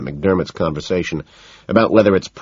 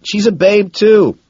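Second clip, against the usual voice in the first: high excited calling; eager, high and loud; 11 semitones higher, 6 dB louder than usual. A narrator's reading. Van Patten calling excitedly.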